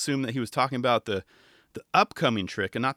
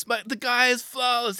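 much higher gushing praise; higher mock commentator gush; 10 semitones higher than usual. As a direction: gush over the skater. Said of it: "But it was hilarious".

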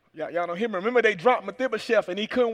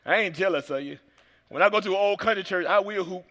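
ordinary talk, nothing marked

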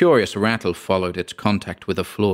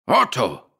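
higher, contained cry of passion; passionate cry; 4 semitones higher than usual. A narrator's reading. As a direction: cry out the name with some passion. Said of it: "cried the other with passion".

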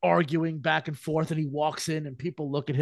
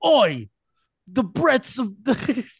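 loud, high hammed-up English accent; slow, raised-pitch English "Oi" voice; 7 semitones higher, 6 dB louder, slower than usual. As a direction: do a broad mock English soldier. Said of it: "that's my English accent".

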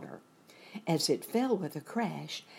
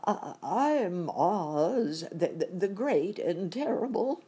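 trembling voice; 4 semitones higher, 4 dB louder than usual. A narrator's reading. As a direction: stumble fearfully through a grand title. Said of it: "said the man in a trembling voice".

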